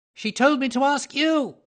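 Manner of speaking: very high, loud whine; whining protest. A narrator's reading. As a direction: plead back at the bully. Little man protests.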